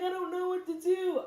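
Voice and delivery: put-on high, whiny crying voice of a panicked girl; high mock-crying whine; mimicking daughter crying. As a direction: whimper it like a teary kid. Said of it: "She started crying".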